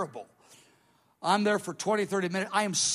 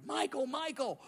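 soft, high retold call; a muted cry of the name, pitch lifting; held-back mock shout for Michael; imitated crying out; 7 semitones higher, 6 dB quieter than usual. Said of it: "I cried".

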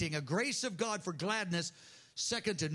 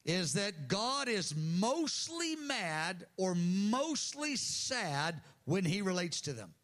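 ordinary delivery

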